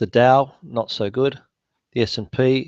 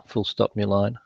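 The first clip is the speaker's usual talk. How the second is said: quieter dictation command aside; dictating; 3 semitones lower, 5 dB quieter than usual. Real, not acted. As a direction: drop the voice to dictate punctuation. Dictating punctuation to software.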